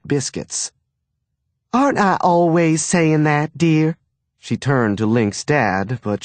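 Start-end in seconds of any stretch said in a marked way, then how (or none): 1.7-4.0 high, prim Southern mother. A narrator's reading. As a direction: sweetly demand agreement. Wife seeks husband's agreement.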